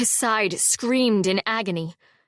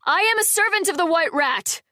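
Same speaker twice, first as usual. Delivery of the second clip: raised, higher projected call; 10 semitones higher, 4 dB louder than usual. A narrator's reading. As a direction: call out across to the trees. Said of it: "Zale called".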